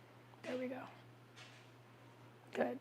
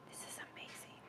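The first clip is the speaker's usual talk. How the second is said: whispered rave about the food; awed whisper; 27 dB quieter, faster than usual. Pretend. To viewers about the seasoning, a hushed whisper. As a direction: hush it like a secret.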